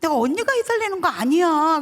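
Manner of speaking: high, loud retold objection; exclaimed, acted-out denial; loud protest. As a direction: protest emphatically to an upset sister. Retold protest to crying sister.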